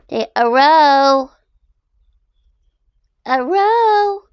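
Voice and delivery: put-on squeaky baby voice; high baby-talk hello. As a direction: coax the dog to greet.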